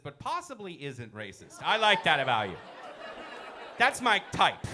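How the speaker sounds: ordinary talk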